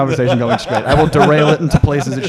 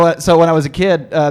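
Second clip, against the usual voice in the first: ordinary talk, nothing marked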